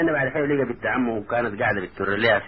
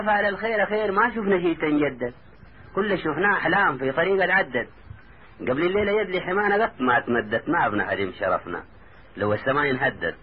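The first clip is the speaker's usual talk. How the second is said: higher-pitched poetic reassurance; verse recitation; 6 semitones higher than usual. A narrator's reading. Taha consoles his weeping cousin, recited in verse. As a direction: recite it lifted, settling at the close.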